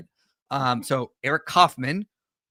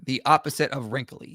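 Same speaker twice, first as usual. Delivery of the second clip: ordinary delivery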